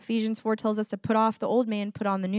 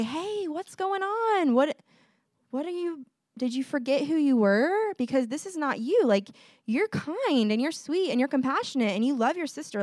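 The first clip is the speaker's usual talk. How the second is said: gentle parent; 4 semitones higher than usual. Retold or imitated. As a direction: gently remind a child who they are, pitched up. Voices parent correcting kids gently.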